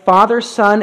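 normal talking voice

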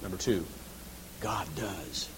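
low, hushed gravity; soft, weighty pronouncement; solemn quiet. Real, not acted. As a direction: let it drop to a sober hush. Solemn point on who judges.

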